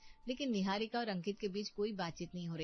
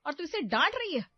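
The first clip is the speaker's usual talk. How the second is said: loud, shrieking threats at daughter-in-law; furious mother-in-law; 4 semitones higher, 7 dB louder than usual. Acted. Mother-in-law berates Barkha to defend her daughter, furious. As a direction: tear into her, high and loud.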